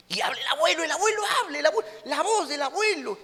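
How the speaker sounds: grandpa's voice. Retold or imitated. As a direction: mimic a demon faking grandpa. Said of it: "reniega como el abuelo".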